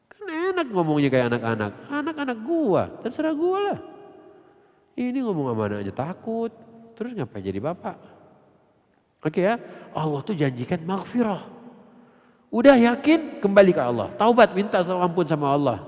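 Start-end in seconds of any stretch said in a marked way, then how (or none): 1.9-3.8 mock bossy parent. Mimics assertive parent. 12.5-15.8 loud, urging, high on udah; preacher urging listeners to repent, loud fervent urging; fervent preaching. Real, not acted. Preacher calling congregation back to Allah, fervent loud urging.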